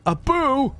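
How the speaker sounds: high, loud cartoon call; playful loud cartoon shout; calling Abu. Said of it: "And then Aladdin would go".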